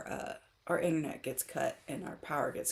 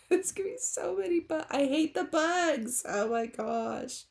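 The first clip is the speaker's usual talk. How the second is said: exasperated whine; 10 semitones higher than usual. Real, not acted. Dreading the mosquitoes.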